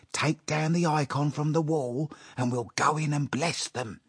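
conspiring with his wife, quivering with impatience; impatient. A narrator's reading. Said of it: "quivering with impatience".

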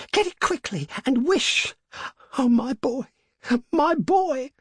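high, desperate gasped cries; panting. A narrator's reading. Mother pleading for her dead boy, panting. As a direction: urge breathlessly, rising to an anguished high cry. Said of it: "she panted".

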